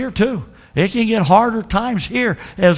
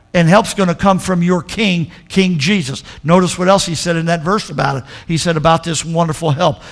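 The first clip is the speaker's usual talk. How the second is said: usual speaking voice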